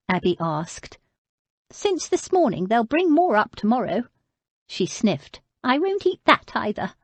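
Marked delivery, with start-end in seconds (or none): 1.7-6.9 put-on higher, querulous old woman's voice; old lady. Old lady refusing food, voiced higher. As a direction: play her as a frail but stubborn old lady.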